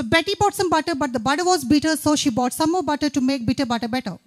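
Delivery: quick, loud, rattled-off tongue twister; fast tongue twister. Shown as a demonstration. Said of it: "this was definitely my famous riddle".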